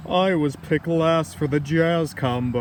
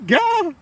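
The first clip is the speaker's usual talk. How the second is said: high sudden shriek of fright; startled yelp; 4 dB louder than usual. Acted. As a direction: jump at the cat with a yelp. Startled by Cat.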